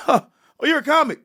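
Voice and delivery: high, delighted cop voice; mock impressed cop. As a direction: play the cop lighting up at a celebrity. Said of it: "my excuse every time would be".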